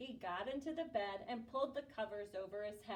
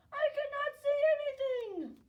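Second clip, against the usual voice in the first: hammed-up wail; 12 dB louder than usual. Toad dazzled by the sun.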